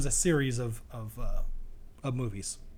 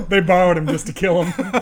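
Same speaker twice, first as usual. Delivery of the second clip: laughing; 3 semitones higher, 10 dB louder than usual. Real, not acted. Laughing at a joke.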